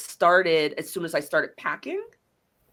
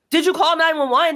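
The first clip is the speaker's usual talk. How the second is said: higher, louder urgent question to mom; pressing mom in a panic, raised; urgent retold question; 8 semitones higher, 4 dB louder than usual. Daughter pressing mom on the phone, urgent and raised.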